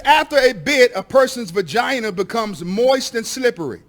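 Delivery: reads the explicit passage out loud and firm; loud reading aloud. Acted. Pastor reads a book passage to the school board, loudly. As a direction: read it out loudly to the board, pushing over interruptions. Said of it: "I'll read some of this for you".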